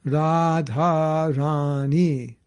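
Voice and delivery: "Radha Rani" very slow, each vowel held long; Raa-dhaa Raa-nee, all stretched evenly; long syllables. Shown as a demonstration. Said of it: "every syllable is long".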